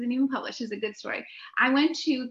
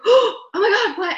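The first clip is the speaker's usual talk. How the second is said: high, loud alarmed exclamation; the worried tour guide, much higher and louder; shocked guide; 10 semitones higher, 10 dB louder, slower than usual. Retold or imitated. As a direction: blurt it out like you just got terrible news. Tour guide reacts, gasping.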